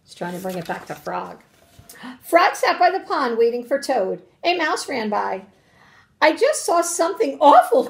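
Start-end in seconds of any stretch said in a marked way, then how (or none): 2.2-5.5 animated, emphatic storybook reading; story read-aloud to children. Acted. 6.2-7.9 high, loud storybook alarm; raised, urgent mouse exclamation; alarmed cry. A narrator's reading. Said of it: "cried the mouse".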